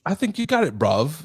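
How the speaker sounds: high, put-on British voice; British accent. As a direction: praise it in a mock British lilt. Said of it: "give me your British accent".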